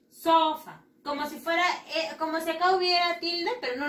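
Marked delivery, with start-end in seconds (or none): 0.1-0.9 "Sofa" high and loud on "So", falling after; stress on O. Shown as a demonstration. Teacher modelling "Sofa" once more, stress on the O. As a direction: lean hard on the "So" of "Sofa". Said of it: "la mayor fuerza de voz le vamos a dar aquí en la O".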